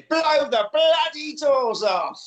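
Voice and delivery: Michael Caine quote. Retold or imitated. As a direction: deliver it like the famous heist line. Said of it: "Michael Caine isn't in it this week".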